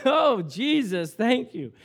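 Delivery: slow, drawn-out loud thanks; fervent shouted gratitude. Retold or imitated. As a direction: praise God out loud. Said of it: "In a loud voice".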